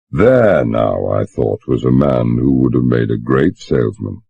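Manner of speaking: plain reading voice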